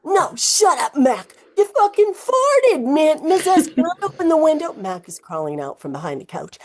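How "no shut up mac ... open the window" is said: put-on high, loud teen boys squabbling; hammed-up teenage boys; bickering boys. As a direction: squeal the accusation. Boys bickering about a fart.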